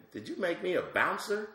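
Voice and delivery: high, rising indignant complaint; hammed-up disbelief; mock outrage. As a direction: play it offended at being the bouncer. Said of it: "I said".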